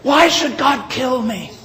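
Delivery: high, loud imitated plea; retold frightened cry. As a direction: cry out as the broken policeman. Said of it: "trembling and crying".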